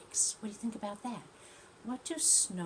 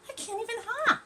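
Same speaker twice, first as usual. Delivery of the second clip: much higher, small plaintive voice; sad little tortoise; 6 dB louder than usual. Acted. Tortoise admitting she can't hop, high plaintive. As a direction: say it like a tiny dejected tortoise.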